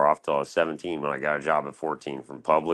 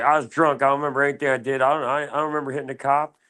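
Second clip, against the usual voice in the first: higher, louder, rushed pleading; mock innocent excuse; 8 semitones higher, 3 dB louder, faster than usual. Fooling the doctor with a drunk excuse, rushed mock innocence. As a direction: play dumb fast to fool her.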